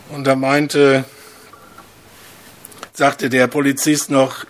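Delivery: normal talking voice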